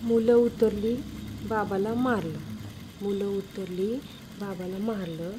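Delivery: rote memorising. Pretend. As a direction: repeat it quietly, learning it by heart. Memorising dictated testimony by repetition. (खालच्या आवाजात घोकंपट्टी)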